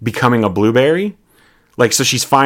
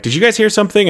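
nervous question; 10 semitones higher, much faster than usual. Acted. Voicing character hearing noise.

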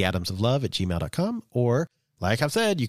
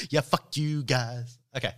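normal talking voice

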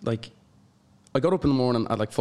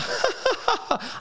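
squealing laugh. Retold or imitated. Retelling his happy tears.

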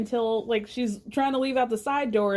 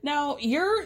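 ordinary delivery

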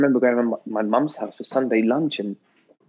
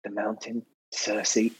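much softer, murmuring names like a hit list; hushed list; 9 dB quieter than usual. Retold or imitated. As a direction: recite the names under your breath, much softer. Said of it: "she's going".

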